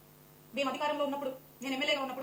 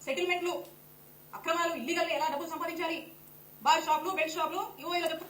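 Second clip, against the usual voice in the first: angry shouting; 2 semitones higher, 5 dB louder than usual. Real, not acted. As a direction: shout each charge at him. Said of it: "నువ్వెంత అరిచినా". (కోపంగా అరుస్తూ ఆరోపణలు)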